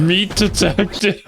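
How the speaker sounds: slow robotic droid voice; flat machine announcement, drawn out. Pretend. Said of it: "one-liner meatbag voice".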